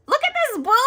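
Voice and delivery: high, loud delighted squeal; excited. Real, not acted. Showing viewers a cute boo, squealing high.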